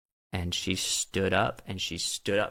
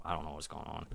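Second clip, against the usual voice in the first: bewildered murmur; 4 semitones lower, 13 dB quieter, faster than usual. Retold or imitated. Bewildered watching the store scene.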